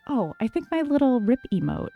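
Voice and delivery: ordinary delivery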